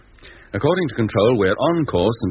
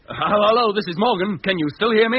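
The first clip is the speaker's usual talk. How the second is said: eager call; 13 semitones higher than usual. Acted. Captain calls Control over radio.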